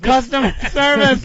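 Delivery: high loud yell of customer service; yelling at phone menu. Pretend. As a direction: shout it at the automated line.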